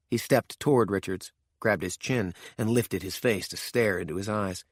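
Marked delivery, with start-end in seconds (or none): none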